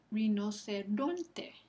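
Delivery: very slow "rinoceronte", leaning on RON; stress on RON. Shown as a demonstration. Teacher confirms the answer, drawn-out "rinoceronte" with stress on RON. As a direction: spell out each syllable of "rinoceronte", stressing RON. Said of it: "Esa penúltima sílaba recibe el acento".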